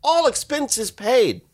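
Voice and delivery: high, excited congratulatory voice; gushing letter voice. Retold or imitated. Host reads the teacher's acceptance letter in high gushing voice. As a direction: read it like a thrilled announcement.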